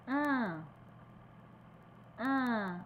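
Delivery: "Un" nasal with the mouth spread wide; teacher demonstrating nasal "Un"; nasal vowel. Shown as a demonstration. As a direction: sound "Un" nasally, lips horizontal. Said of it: "avec la bouche horizontale".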